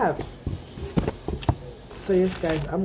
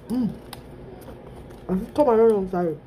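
chewing while talking; talking between bites; mouth full; 4 dB quieter, faster than usual. Real, not acted. Seen 0: Bites sandwich at -1s, then chews with closed mouth while talking.

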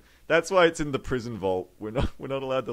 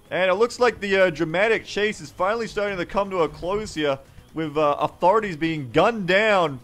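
raised, urgent chase commentary; mock dramatic newscaster; news reporter; 6 semitones higher, 3 dB louder than usual. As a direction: narrate a police chase live on TV.

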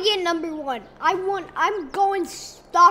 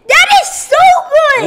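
much higher and much louder, gushing; excited squeal; 9 semitones higher, 13 dB louder than usual. Pretend. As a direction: squeal with delight for dad. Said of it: "I wanna hear something good".

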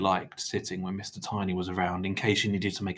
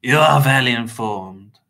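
slow, louder grudging reply; grunted reply; 3 semitones higher, 8 dB louder, slower than usual. A narrator's reading. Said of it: "Mister Crepsley grunted".

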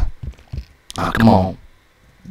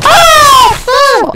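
loud, piercingly high "ah"; high scream; 6 dB louder than usual. Real, not acted. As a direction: scream out as it goes wrong. Scream on failing in game.

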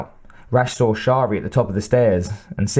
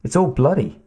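put-on young boy voice; 5 semitones higher than usual. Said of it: "the boy said".

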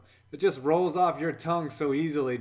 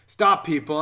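normal talking voice

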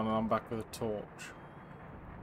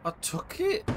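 defensive squeak; slower than usual. Real, not acted. Defensive reply to doctor voice.

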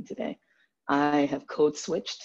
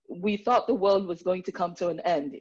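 normal talking voice

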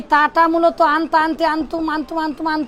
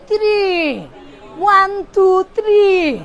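put-on higher, Arabic-accented counting in English; 3 semitones higher than usual. Teacher imitating Arabs counting, Arabic accent. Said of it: "থ্রির উচ্চারণ তো হয় না".

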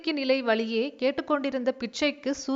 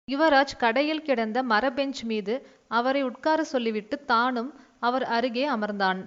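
ordinary delivery

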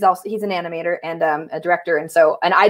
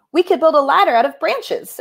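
lightly voiced duck, higher pitch; duck camper; 7 semitones higher than usual. Duck offering a rescue idea, lightly raised voice. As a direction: suggest it in a slightly put-on high voice. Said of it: "the duck is saying".

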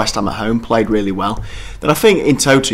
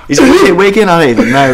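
laughing through the words, higher and louder; 10 semitones higher, 8 dB louder, faster than usual. Real, not acted. To his co-host at a flop signing, laughing. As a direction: question pushed out between laughs.

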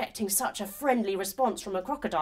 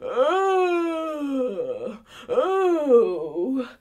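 lazy crocodile groan; 4 dB louder than usual. Acted.

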